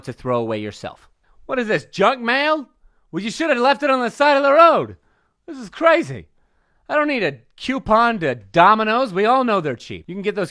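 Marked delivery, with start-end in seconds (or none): 1.5-9.1 higher-pitched comic indignation; mock exasperation. Comedian playing a victim handed back junk mail, mock exasperated. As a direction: grumble in exaggerated disbelief, pitched up.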